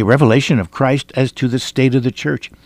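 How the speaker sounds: normal talking voice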